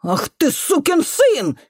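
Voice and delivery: high, drawn-out angry curse; outraged exclamation. A narrator's reading. Janitor woman thinking the boy would hit her, outraged. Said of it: "воскликнула она".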